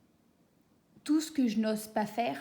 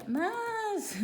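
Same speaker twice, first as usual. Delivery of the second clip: alarmed cry; 12 semitones higher than usual. Acted. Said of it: "mon esprit inconscient s'est dit".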